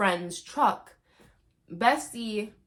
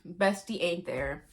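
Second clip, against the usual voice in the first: normal talking voice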